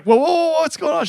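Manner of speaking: high, loud imitated yelp of protest; alarmed protest. Retelling how Cliff yelled at the clerk, imitating his startled shout. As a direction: act out the friend's startled outcry.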